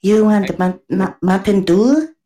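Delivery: slow, high-pitched mock Chinese accent. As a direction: imitate a Chinese server taking drink orders, high and drawn out. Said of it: "they come up and talk to you, and it's like".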